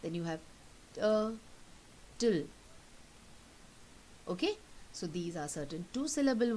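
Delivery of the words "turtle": "turtle" in two beats, TUR, long pause, TLE; split into syllables. Shown as a demonstration. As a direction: break "turtle" after the first syllable. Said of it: "take the two syllables apart".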